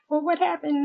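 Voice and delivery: high-pitched imitation of concerned family; acted puzzled question. Family questioning her as she bawls, mock concern.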